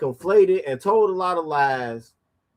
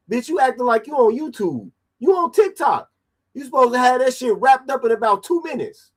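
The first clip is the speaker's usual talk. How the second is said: much higher, fast, incredulous scolding; exasperated mockery; 7 semitones higher than usual. Real, not acted. Commentator roasting the mother's long TikTok, high-pitched and exasperated. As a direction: roast her for rambling, faster.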